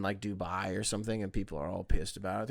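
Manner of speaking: normal talking voice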